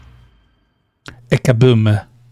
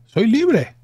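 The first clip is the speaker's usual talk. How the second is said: much higher, soaring cry of freedom; soy libre cried out far higher than the first time; ecstatic cry; 11 semitones higher than usual. Acted. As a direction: shout it out, finally free at last. Character celebrates, repeated higher.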